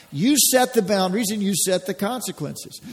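normal talking voice

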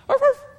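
high, loud yappy arf arf; mock puppy barks. Woman playing the puppy for Jesus, high mock barks. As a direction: bark like a little puppy.

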